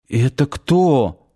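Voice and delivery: slow, guilty question. Acted. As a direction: ask it sheepishly, feeling guilty. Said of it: "виноватым голосом спросила Надя". (виновато, медленно)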